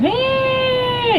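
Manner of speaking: high siren wail; siren imitation. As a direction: mimic a passing siren. Imitating siren of fire truck.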